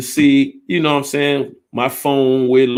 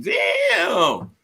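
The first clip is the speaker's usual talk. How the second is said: high, cracked hammed-up cry; student mock-crying over a bad grade; mock wail. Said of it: "You be like".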